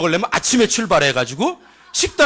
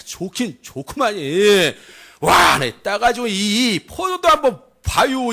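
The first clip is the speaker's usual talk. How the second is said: marvelling Chungcheong storyteller; Chungcheong dialect. Acted. Returned spy shows off giant fruit, Chungcheong accent. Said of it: "충청도 버전으로 한번 해보도록 하겠습니다".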